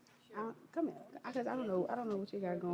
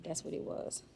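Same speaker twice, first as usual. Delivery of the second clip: whispered apology; 3 semitones lower, 9 dB quieter than usual. Real, not acted. Hushed apology to child.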